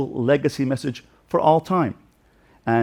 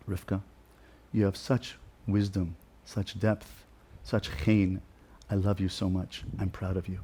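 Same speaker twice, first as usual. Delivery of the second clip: much softer, hushed and close to her ear; tender whisper; 4 semitones lower, 10 dB quieter than usual. Retold or imitated. Father whispering praise to his daughter Rivka, soft and tender. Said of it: "And I gave her a whisper".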